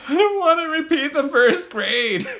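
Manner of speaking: put-on high, slow, wailing kid voice with a laugh breaking through; mock crying child. Little girl in tears at the teacher's desk, mock wailing. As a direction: whine it like a tearful six-year-old. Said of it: "She's like".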